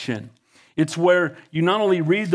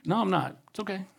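calm reply; 8 dB quieter, faster than usual. Retold or imitated. Retold calm answer to doubters.

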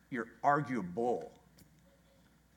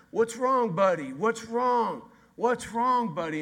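higher, louder coaxing dad voice; worried dad; 9 semitones higher, 5 dB louder, slower than usual. Retold or imitated. Dad in the car asking his crying son, high and coaxing. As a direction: coax the upset child gently but urgently.

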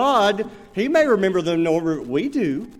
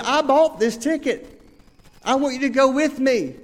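exasperated repeat; 5 semitones higher than usual. Retold or imitated. To a friend who won't listen, exasperated insistence. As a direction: push it like you've said it three times. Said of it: "I'm frustrated".

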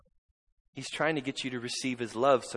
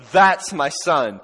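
proud proclamation; 3 semitones higher, 13 dB louder, much slower than usual. Acted. Heaven proclaims.

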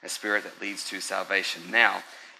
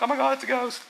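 much higher, squealing mock panic; mock frightened disciples; 4 dB louder, faster than usual. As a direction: play the spooked disciples shrieking at a ghost. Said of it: "They're freaking out".

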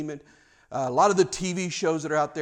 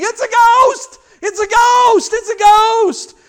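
high, loud mock scream of terror; panicked scream; 11 dB louder, slower than usual. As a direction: scream it out like terrified sailors, last one drawn out. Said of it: "they're just screaming".

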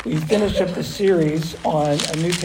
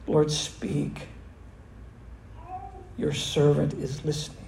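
sincere whispered prayer; 3 semitones lower, 7 dB quieter than usual. Pretend. The church praying Samuel's words, whispered sincerely. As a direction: let it fall to a near whisper of surrender. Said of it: "say with all sincerity".

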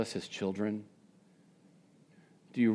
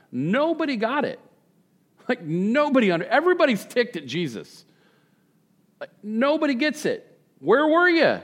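exasperated outburst; 11 semitones higher, 4 dB louder than usual. Acted. Voicing crowd's frustration at Jesus.

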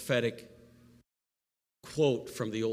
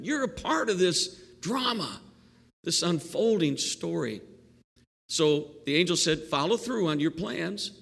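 excited angel; 6 semitones higher, 4 dB louder than usual. Retold or imitated. Pastor voices the angel excitedly.

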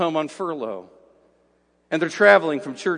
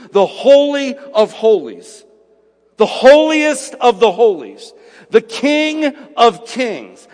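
loud, slow, emphatic pulpit delivery; fervent sermon climax, hammering every word; preaching at full force; 5 semitones higher, 7 dB louder, slower than usual. Acted. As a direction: drive each phrase home from the pulpit. Preaching about life's brevity.

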